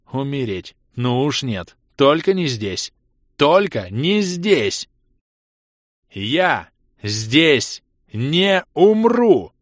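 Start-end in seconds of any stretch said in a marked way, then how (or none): 3.4-4.9 slower, louder, higher resolve; rising fury. Acted. Ros refuses to die here, louder and slower. 6.1-9.5 very slow, loud, high roar; furious cry. Acted. Ros roars his refusal to die, echoing off ice. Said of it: "Мой яростный крик".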